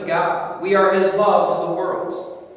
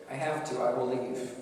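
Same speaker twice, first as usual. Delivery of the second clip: soft resignation; 4 semitones lower, 9 dB quieter than usual. Acted. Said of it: "Yet God says".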